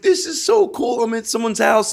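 high, giddy, thrilled gush; excited gushing. Retold or imitated. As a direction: gush like a kid amazed to be invited. Excited guest at someone's house.

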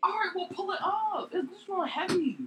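heated yelling. Real, not acted. Arguing over charger.

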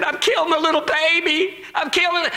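high imitated sobbing confession; crying. Preacher voices the girl in the clinic, crying. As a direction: pitch it up into an acted, anguished wail. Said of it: "She said, was crying".

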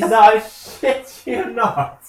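loud, high, drawn-out mimicry; mocking echo. Echoing the co-host's phrase back, slow high mock shout.

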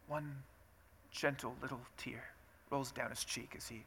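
very soft, delicate narration; mock-tender hush. Preacher describing the film's gentle tear, hushed. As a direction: barely voice it, like a precious little moment.

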